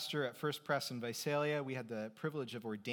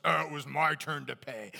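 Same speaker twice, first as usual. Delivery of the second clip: loud, protesting at the register; mock protest; 6 dB louder than usual. Friends at the register arguing over the bill, mock protest. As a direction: insist it was your turn, louder. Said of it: "everyone else says".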